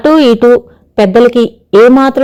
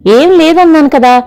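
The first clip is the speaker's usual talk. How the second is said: higher, impatient protest; mild irritation; 7 semitones higher than usual. A narrator's reading. Daughter fending off mother's questions, mildly irritated. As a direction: protest as if tired of being asked. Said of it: "విసుక్కుంటూ".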